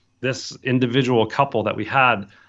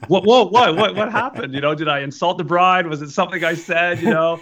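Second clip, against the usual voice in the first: higher-pitched, startled questions; retold alarm; 7 semitones higher than usual. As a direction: act out a worried, stammering reaction. Retold reaction to bad news.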